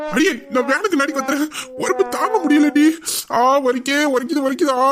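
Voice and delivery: high, indignant howl; hammed-up agony, repeated ows; pained yelling. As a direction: wail as if still burning. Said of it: "இப்படி கத்துறாரு".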